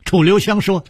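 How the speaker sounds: plain reading voice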